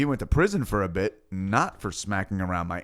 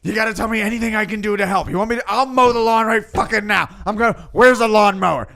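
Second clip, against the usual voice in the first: high, loud and fast, desperate to help; frantic eager offer; 11 semitones higher, 8 dB louder than usual. Retold or imitated. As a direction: rattle it off, overeager. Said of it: "he was like".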